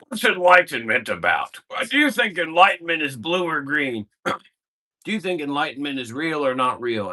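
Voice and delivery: lightly mocking voice of a rambling group member; imitating the questioner. Teacher mimics a confused man's rambling questions, mocking. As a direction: parody him as a muddled seeker with a throat clear. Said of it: "I wish he said it like that".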